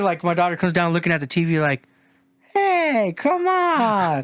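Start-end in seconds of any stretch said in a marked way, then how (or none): 2.5-4.2 put-on high, drawn-out kid's complaint; mock whiny protest. Imitating a daughter complaining at the TV, high whiny protest. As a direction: plead it high, stretching each word.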